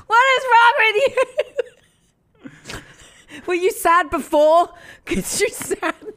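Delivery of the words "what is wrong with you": exasperated yell through laughter; mock-angry shout. Real, not acted. Co-host yelling at host over his blunder, mock-furious shout. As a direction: shout it louder and higher at a friend. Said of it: "I'm so mad at you right now".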